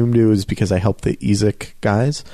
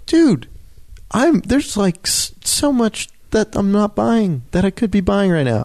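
exasperated; 10 semitones higher than usual. Retold or imitated. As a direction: argue back in disbelief. Retold outburst at jealous neighbors.

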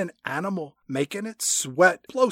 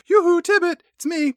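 high mock yoo-hoo call; 11 semitones higher than usual. Bond calling out to Tibbet.

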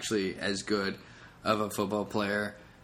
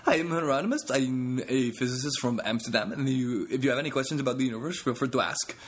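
put-on Dutch accent; 3 semitones higher than usual. Comic impersonating Dutch friend Hieronymus introducing himself, Dutch accent. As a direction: play the cocky Dutch physicist. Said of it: "a Dutch accent".

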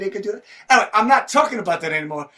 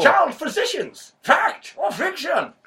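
slow, high announced title; grand title reading; 9 semitones higher, slower than usual. Acted. Reading the book title to the host, slow and grand.

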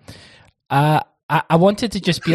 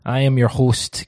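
ordinary talk, nothing marked

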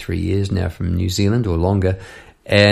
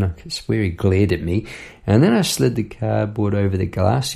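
normal talking voice

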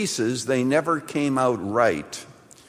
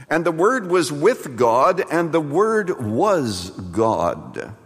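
slow reverent reading; 2 semitones higher, slower than usual. Acted. Preacher dwells on scripture.

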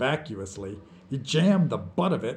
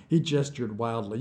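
ordinary delivery

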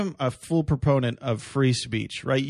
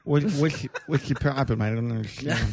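mocking speech impediment voice. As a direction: talk like your tongue is too big. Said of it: "a guy with, like, a tongue that's too big".

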